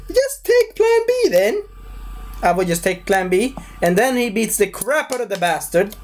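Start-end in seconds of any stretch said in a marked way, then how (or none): 0.1-1.6 very high, squealed put-on voice; hammed-up falsetto; squeaky villain quote. Acts out the villain's scene.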